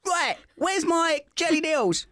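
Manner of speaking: very high, drawn-out Cockney-style demand; hammed-up high East End voice; in-character Essex role. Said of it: "in character and then you never leave".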